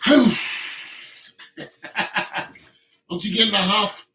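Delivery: loud mocking question; loud teasing. Real, not acted. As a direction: needle her loudly.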